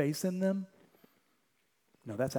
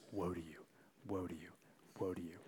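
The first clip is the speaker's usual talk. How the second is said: quiet lament; 6 semitones lower, 14 dB quieter, faster than usual. Retold or imitated. Voicing Jesus' quiet lament.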